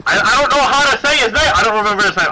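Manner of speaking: high, loud exasperated cry; frustrated outburst. Real, not acted. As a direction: burst out in frustration. Frustrated, can't recall the name.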